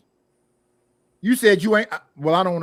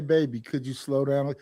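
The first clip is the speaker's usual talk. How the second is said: sweet request; 7 semitones lower, 6 dB quieter than usual. Retold or imitated. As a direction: lower and softer, gently coaxing. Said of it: "You can say it in a sweet way".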